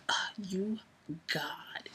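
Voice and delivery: whispered, swooning oh God; breathless whisper. Retold or imitated. Swooning at his greeting, a breathless whisper. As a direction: breathe it out, overwhelmed.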